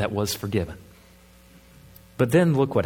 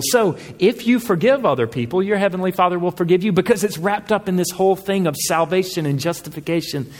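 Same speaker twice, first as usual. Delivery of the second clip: higher, louder, driving sermon voice; full-force preaching; 6 semitones higher, 3 dB louder than usual. Acted. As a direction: preach it hard to the congregation.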